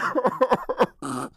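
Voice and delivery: high burst of real laughter; breaking into giggles; laughing. Real, not acted. Laughs after saying she'll cry.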